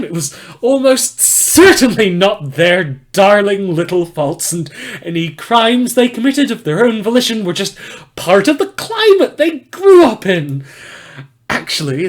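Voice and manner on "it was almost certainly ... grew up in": sarcastic. Acted. Sarcastic mock sweetness.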